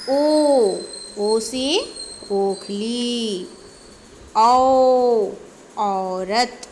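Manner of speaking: drawn-out teacher's vowel drill; slow primer read-aloud, words held long; alphabet recitation. Shown as a demonstration. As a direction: stretch the long vowels on a steady note. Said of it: "पढ़ करके सुनाने वाले हैं".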